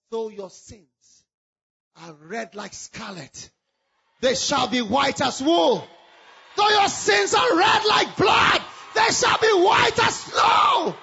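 scripture read slowly, rising from a hush to a shout; scripture building. Acted. Pastor quotes scripture, building.

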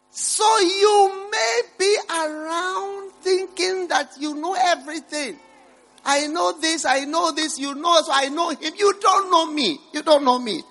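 full-force sermon cry; preaching at full force. Acted. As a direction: thunder it from the pulpit.

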